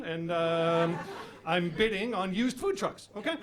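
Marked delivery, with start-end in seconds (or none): none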